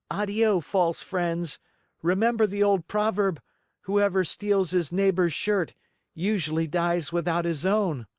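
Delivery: high, firm dismissive farewell; scornful puppet. Acted. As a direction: turn them away with scorn. Pinocchio's final rebuff.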